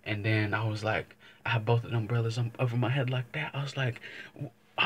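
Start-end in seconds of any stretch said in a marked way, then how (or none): none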